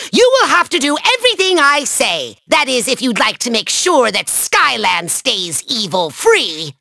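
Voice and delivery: ordinary delivery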